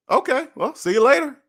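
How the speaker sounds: high, airy okay well see you later; carefree goodbye. Pretend. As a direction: shrug it off, bright and high. Shrugging off a rejection.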